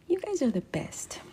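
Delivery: soft breathy whisper of thanks; whispering thanks to her viewers. Real, not acted. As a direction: whisper it close to the mic.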